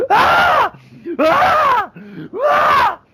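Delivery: high, loud dying shrieks; undead knight shrieking. Acted. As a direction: shriek in agony as the flesh falls away. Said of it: "start shrieking".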